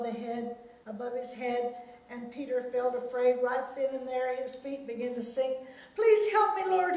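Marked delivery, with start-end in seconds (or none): 5.9-7.0 high, loud plea for rescue; desperate prayer. A narrator's reading. Peter sinking in the waves, crying out in prayer. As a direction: cry out in sudden panic.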